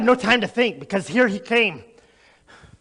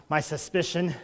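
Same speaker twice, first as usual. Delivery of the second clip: ordinary talk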